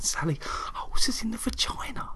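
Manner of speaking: high hushed whisper to his wife; high, shocked whisper; scared whisper. Retold or imitated. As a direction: whisper it, shocked, so nobody hears. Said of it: "I'm scared".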